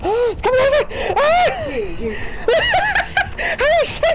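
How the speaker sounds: high, loud panicked screaming; terrified shrieking. Real, not acted. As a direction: scream as it comes for you. Monster climbing over.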